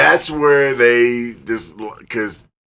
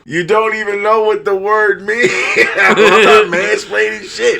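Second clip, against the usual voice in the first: high, exasperated retold protest; mock indignation; 9 semitones higher, 4 dB louder than usual. Retelling an argument with ex-girlfriend about mansplaining, mock indignant. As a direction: act out being outraged, half laughing.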